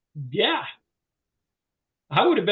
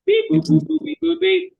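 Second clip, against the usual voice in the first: high, loud mock phone keypad beeps; dialing beeps; 10 semitones higher, 5 dB louder than usual. Salesman mimics dialing the insurer's number, loud beeping. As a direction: chirp out the dial tones like pressing buttons. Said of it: "I just start dialing".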